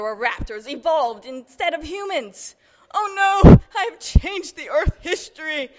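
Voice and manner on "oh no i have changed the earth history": high, very loud melodramatic cry; horrified yell. A narrator's reading. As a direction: wail it loudly in mock horror. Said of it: "yelled the Doctor".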